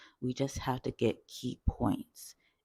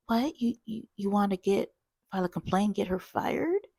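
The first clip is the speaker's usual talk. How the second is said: high-pitched incredulous questioning; flustered coworker; 8 semitones higher, 4 dB louder than usual. Retold or imitated. Coworker repeating the caller's threat, incredulous. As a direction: act out a stunned coworker, higher and louder. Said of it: "sounded like she got hit by a tornado".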